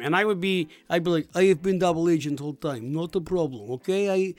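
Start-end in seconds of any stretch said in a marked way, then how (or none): 1.3-4.3 put-on Russian accent, slightly lowered. Shown as a demonstration. JJ convincing his new comrades he's loyal, Russian accent. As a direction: speak English like a Russian double agent. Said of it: "you can do your accent".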